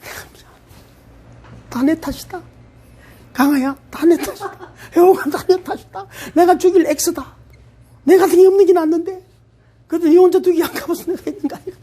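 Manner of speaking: high, laughing mock-tearful confession; hammed-up weeping apology. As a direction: overplay the sorry, half laughing. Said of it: "이러면 안 돼".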